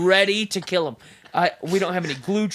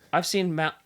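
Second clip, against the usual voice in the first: ordinary delivery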